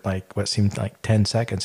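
normal talking voice

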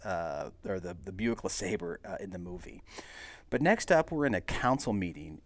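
ordinary delivery, nothing marked

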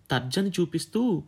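ordinary delivery, nothing marked

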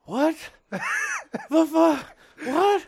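high, loud, halting mock disbelief; imitated bewilderment. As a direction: yelp each bit as a baffled friend would. Kyle still acted as bewildered.

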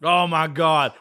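ordinary talk

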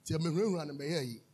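ordinary talk, nothing marked

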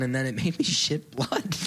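ordinary delivery

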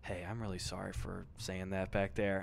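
low, soft imitation of an apologetic dad; subdued, contrite mock-dad voice; sheepish retold apology. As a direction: apologize quietly, pitch dropped.

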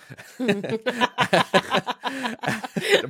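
a high burst of real laughter. Real, not acted. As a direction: laugh out loud at your own joke. Laughs at own remark.

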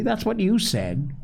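high-pitched, mildly testy retort; irritable. A narrator's reading. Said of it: "repeated Joel irritably".

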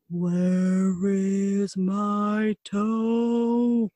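slow drawn-out moaning voice; groaning ghost. Acted. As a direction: moan it very slowly, like a ghost. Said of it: "the voice groaned".

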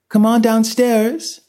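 high, slow, wavering woman's call. A narrator's reading. Mother calls the twins downstairs, wavering. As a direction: call upstairs slowly in a high, unsteady voice. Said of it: "Janet called in a wavering voice".

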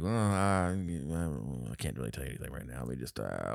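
low, very soft hesitant imitation; awkward stalling. Peter dodging Emma's question, hesitant and quiet. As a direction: trail into a soft, evasive mumble.

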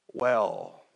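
ordinary talk, nothing marked

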